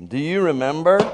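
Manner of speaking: normal talking voice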